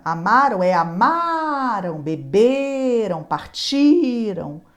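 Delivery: Amaram, beberam and partiram stressed on the syllable before the end, slowly; stress on the middle syllable. Shown as a demonstration. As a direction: drag out beberam and partiram, leaning on the ma, be and ti. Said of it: "Observe também a sílaba tônica".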